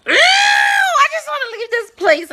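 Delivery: high, loud, slow hammed-up yell of disgust; disgusted shout. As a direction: wail it out grossed out. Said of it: "I was just like".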